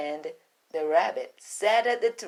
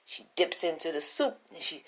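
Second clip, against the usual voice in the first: ordinary delivery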